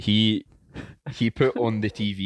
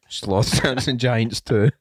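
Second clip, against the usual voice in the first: starts with a laugh, pushes through the title a bit louder; breaking into laughter; 3 semitones higher, 4 dB louder, slower than usual. Shown as a demonstration. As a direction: try to keep it together and crack. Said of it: "try and say it without laughing".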